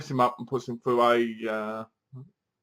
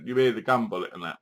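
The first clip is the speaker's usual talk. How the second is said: ordinary talk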